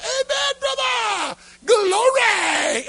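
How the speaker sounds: very high, loud revival shout; hammed-up shouts of praise; hollered amens. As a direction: whoop and holler like an excited churchgoer. Acting out excited congregation response.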